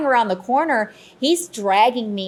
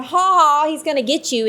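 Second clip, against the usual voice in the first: higher, teasing sing-out; mock taunt; 7 semitones higher than usual. Medium teasing a crew member about the spirit, taunting.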